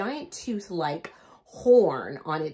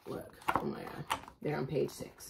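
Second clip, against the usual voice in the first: hushed gossip; 9 dB quieter than usual. Acted. Mrs Claus gossiping.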